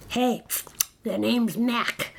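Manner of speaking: slow, scruffy monster voice; slobbery monster hello. Acted. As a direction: introduce yourself as a big scruffy monster. Scruffy monster introduces himself.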